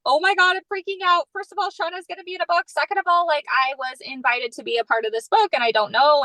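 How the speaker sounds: high, rapid flustered excitement; freaking out. Retold or imitated. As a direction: tumble the words out in a panic of joy. Freaking out to husband in garage.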